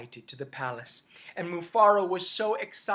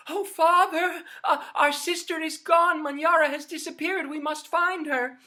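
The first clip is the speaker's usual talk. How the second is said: high, loud, hurried alarm; urgent plea to father; girl telling father her sister is missing; worried daughter; 10 semitones higher, 8 dB louder than usual. Acted. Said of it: "Nyasha said".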